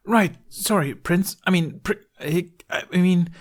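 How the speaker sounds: high, tripping over his words; a nervous boy correcting himself; flustered Fishlegs. Acted. Fishlegs fumbling how to address Hiccup, flustered.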